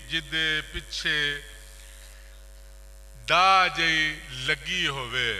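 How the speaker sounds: measured, projected ghazal recital; poetry recitation. Acted. Poet reciting a ghazal's opening couplet, declaimed. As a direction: declaim in separate phrases, each line-end falling.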